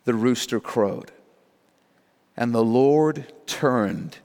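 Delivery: subdued reading with long pauses; solemn scripture reading. A narrator's reading. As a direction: let each phrase land gravely. Solemn reading of gut-wrenching verses.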